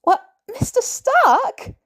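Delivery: high, slow bewildered question; utterly lost teen asking; high confused what. A narrator's reading. Confused Peter after yelp.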